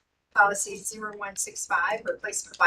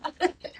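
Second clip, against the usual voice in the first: short laugh; 9 semitones higher than usual. Real, not acted. Laughs after admitting mistake.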